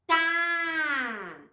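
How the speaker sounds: Tá drawn out on the vowel; blended syllable demo. Shown as a demonstration. Teacher showing the tongue movement, sounded out. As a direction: tap the T, then hold the á in Tá. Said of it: "a língua vai lá em cima e desce".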